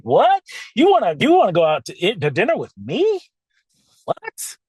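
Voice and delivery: high, loud disbelieving what; floored that he'd ask; stunned, incredulous burst; flabbergasted. Retold or imitated.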